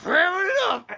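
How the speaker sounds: high-pitched mock shout; hammed-up catchphrase. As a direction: shout it way up high like a running joke. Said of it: "whenever we talk about somebody overacting".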